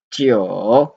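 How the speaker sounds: Jiu dipping low, then rising; dipping Mandarin tone on Jiu; third tone. Shown as a demonstration. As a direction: swing Jiu down and back up. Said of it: "in third tone".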